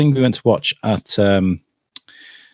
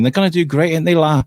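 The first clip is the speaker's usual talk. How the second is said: imitating Wrexham locals, pitched up; higher-voiced Wrexham chat with "la" on the end; Wrexham accent; 6 semitones higher than usual. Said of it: "you hear people talking".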